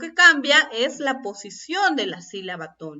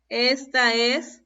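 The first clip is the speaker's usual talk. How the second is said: ordinary talk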